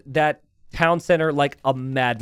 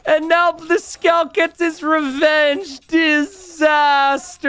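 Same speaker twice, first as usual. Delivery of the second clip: much higher, drawn-out disbelief; excited, high and slow; 13 semitones higher, 5 dB louder, much slower than usual. Real, not acted. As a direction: stretch every word, still half laughing. Caster sees a disaster.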